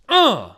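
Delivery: high, loud grunt of food pleasure; relishing grunt. Real, not acted. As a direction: let out a punchy, delighted "uh". Savoring the hot dog he describes.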